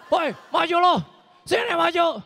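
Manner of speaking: hammed-up brawl shout; brawler yell. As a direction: shout the dare across the street. Brawler yelling challenge.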